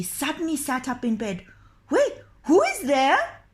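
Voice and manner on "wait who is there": put-on high, sharp old woman voice; a high crone calling out to the window; demanding old woman. The old woman challenges the doctor at the window, high and demanding. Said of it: "she demanded".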